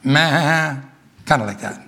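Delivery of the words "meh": a short imitated calf bawl, meh. Shown as a demonstration. Preacher imitating a calf that wants milk: a short mock bawl. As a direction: bawl like a hungry calf, lightly. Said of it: "this is what a calf kinda sounds like".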